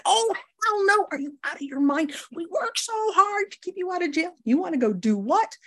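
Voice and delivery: alarmed lawyer scolding the client; shocked attorney. Retold or imitated. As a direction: gasp it out like the lawyer can't believe it. Attorney shocked by amends plan.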